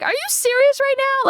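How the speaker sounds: high, outraged Are you serious; shocked at the price tag; outraged disbelief. Retold or imitated. Offended by the price.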